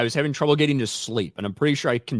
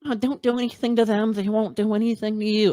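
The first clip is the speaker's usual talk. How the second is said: mocking quote; 11 semitones higher than usual. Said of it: "None of this".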